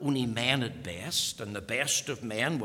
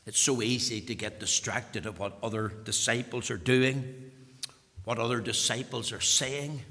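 ordinary talk, nothing marked

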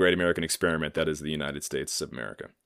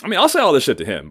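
sudden loud outburst; 8 semitones higher, 9 dB louder, faster than usual. Real, not acted. Defiant outburst.

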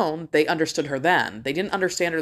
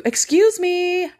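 high, loud, drawn-out mock-polite call; sing-song; 6 dB louder, much slower than usual. Shopper to blockers who won't move, pointedly sing-song. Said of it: "you have to get all fucking sing-song with it".

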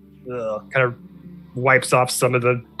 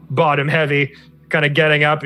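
usual speaking voice

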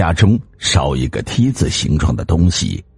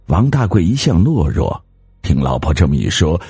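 usual speaking voice

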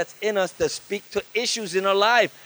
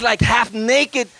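ordinary talk, nothing marked

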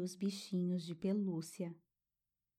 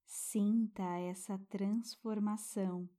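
guided meditation. Acted. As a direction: soothe a child toward sleep.

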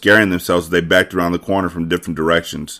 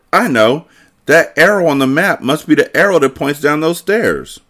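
eager, high-pitched discovery; calling out; 8 semitones higher than usual. A narrator's reading. Boys hunting for clues, eagerly calling out. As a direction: call it out with a kid's excitement. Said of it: "calling out".

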